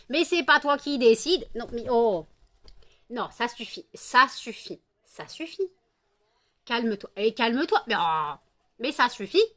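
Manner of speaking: scolding cats. Real, not acted. Owner scolding two fighting cats, firm loud telling-off. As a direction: tell the cats off firmly.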